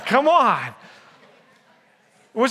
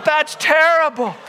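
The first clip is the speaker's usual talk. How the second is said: mock dismay; 5 semitones higher than usual. Real, not acted. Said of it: "mock disgust after joke".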